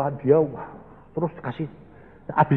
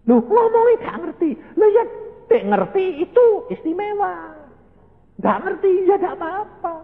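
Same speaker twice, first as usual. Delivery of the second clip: much higher, playful back-and-forth; preacher acting out a puzzled exchange, voice pitched high; mock exclaimed voice. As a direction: act out both sides, pitched way up. Preacher voicing his dialogue.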